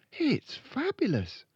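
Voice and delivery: put-on high little-girl voice; high girl character; admiring Matilda. A narrator's reading.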